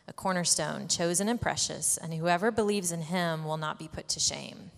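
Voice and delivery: ordinary talk, nothing marked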